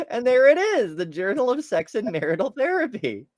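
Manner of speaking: usual speaking voice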